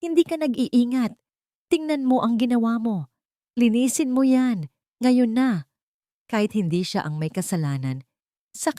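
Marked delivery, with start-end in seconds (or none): 0.0-5.6 angry scolding. A narrator's reading. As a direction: bark the orders at her. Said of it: "sigaw agad ni Mateo".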